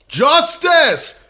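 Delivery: high, loud triumphant cry; cheering shout. Real, not acted. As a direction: cheer it like your team just scored.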